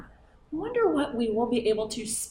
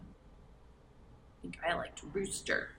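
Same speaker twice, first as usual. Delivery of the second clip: whispered; 5 semitones lower, 16 dB quieter, slower than usual. Acted. Whispered aside.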